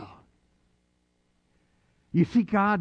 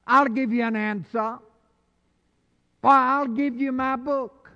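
full-force preaching; 7 semitones higher than usual. Acted. Pastor preaching God's promise to the church, full force. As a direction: preach it out as God's own words.

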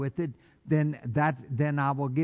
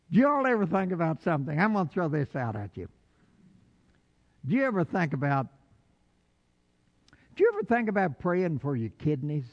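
rushed questions; faster than usual. Real, not acted. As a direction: tumble the questions out quickly. Rushed musing to congregation.

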